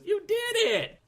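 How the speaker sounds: high, squeaky excited cheer; Pee Wee Herman voice. Retold or imitated. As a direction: squeal it like a manic man-child. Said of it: "it sounds like Pee Wee Herman says".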